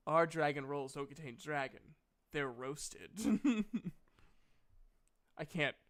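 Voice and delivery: ordinary talk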